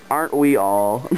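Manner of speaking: usual speaking voice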